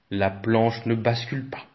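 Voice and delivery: ordinary delivery, nothing marked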